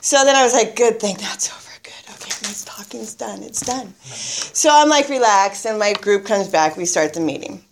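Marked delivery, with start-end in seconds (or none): 0.8-3.9 soft, half-whispered inner voice; retold private relief, dropping very quiet; hushed relief to self. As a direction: mutter it to yourself, relieved. Retold relieved thought to herself.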